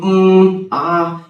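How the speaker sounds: hesitant um and ah, drawn out; filler sounds. Shown as a demonstration. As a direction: demo the fillers a nervous speaker makes. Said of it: "ये जो सारे sound होते हैं".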